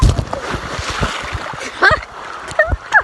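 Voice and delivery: high airy laugh; breathless laugh. Real, not acted. After waterfall slide.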